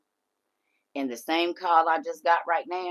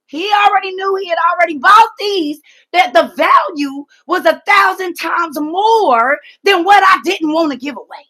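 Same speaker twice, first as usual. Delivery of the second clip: loud, high, crying-out testimony; emotional shout; 14 semitones higher, 15 dB louder than usual. Real, not acted. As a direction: shout it out, overwhelmed. Emotional climax of the story.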